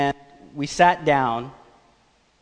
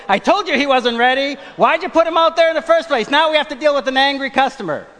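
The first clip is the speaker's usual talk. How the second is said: much higher and louder scolding; mock an old man berating his wife; imitated yelling; 9 semitones higher, 5 dB louder than usual. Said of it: "he was yelling at the grandmother".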